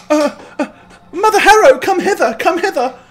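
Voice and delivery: high, loud call across the grove. Acted. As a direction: call the Mother over. Sister calls out to Mother.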